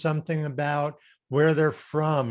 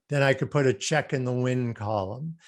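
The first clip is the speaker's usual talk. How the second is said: ordinary talk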